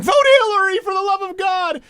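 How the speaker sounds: high, loud, hammed-up desperate plea; desperate shout. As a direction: shriek it like a panicked celebrity. Mocking celebrities begging votes.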